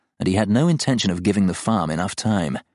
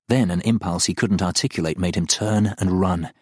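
plain reading voice

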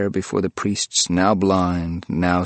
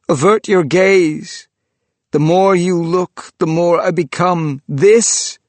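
higher, louder, slow anguished pleading; pleading ghost; 9 semitones higher, 6 dB louder, slower than usual. Acted. Trapped ghost begging the priest to avert his gaze. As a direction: beg him to look away, voice straining.

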